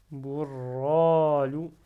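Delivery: "Bourralou" slowly, r doubled and retained; held-back r. Shown as a demonstration. Teacher repeating the shadda example, held r. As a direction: keep back the r in Bourralou, then a long loud "ra". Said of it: "je le retiens".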